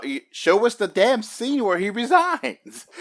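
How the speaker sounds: high, slow exasperated outburst; exasperated demand. Real, not acted. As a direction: demand it, fed up and loud. Frustrated demand at the movie.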